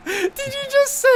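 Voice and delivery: surprised squeal. Real, not acted. Reacting to a joke.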